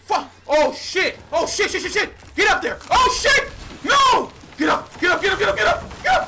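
frantic high shouting; swearing tirade. Real, not acted. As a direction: yell in panic at the screen.

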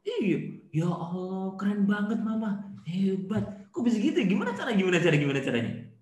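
mock-fascinated, over-the-top listener; feigned amazement. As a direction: pretend to be hugely impressed by wife. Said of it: "pura-pura".

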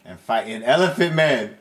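ordinary talk, nothing marked

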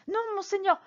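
high, hurried frightened denial; panicked retraction. A narrator's reading. Prisoner retracting his accusation before the cardinal, panicked high cry. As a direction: backpedal in sudden fear. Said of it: "s'écria Bonacieux".

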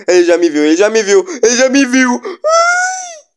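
building to a high scream; rising panic. Real, not acted. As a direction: panic climbing into a shriek. Monster spots him, rising panic.

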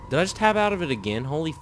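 high, loud, fast disbelieving outburst; incredulous. Real, not acted. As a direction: blurt it out in shock. Game closed itself again.

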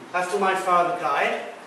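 ordinary delivery

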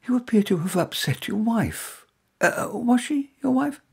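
curious woman. A narrator's reading.

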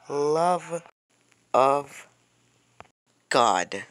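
LOVE, OF, GOD each loud and separate; exasperated word-by-word emphasis. Real, not acted. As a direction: punch "Love of God" out with long pauses. Exasperated oath repeated word by word.

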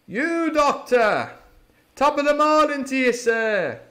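high, loud, beaming hail; cheery cry. A narrator's reading. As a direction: greet him loudly with good nature. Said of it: "cried Silver".

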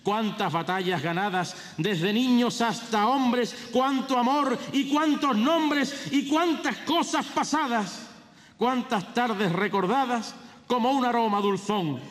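high, ringing proclamation of verse; pregón herald. Acted. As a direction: declaim it like a festival herald.

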